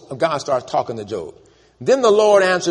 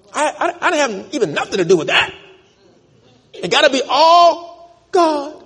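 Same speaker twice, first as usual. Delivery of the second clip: blaming God; 13 semitones higher, 6 dB louder than usual. Retold or imitated. A sufferer blaming God, high whiny excuse. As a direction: whine that it's all God's fault.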